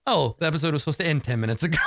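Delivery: fast, laughing panic; fast amused self-quote; hurried realisation. Retold or imitated. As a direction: rattle it off in mock alarm. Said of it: "I'm like".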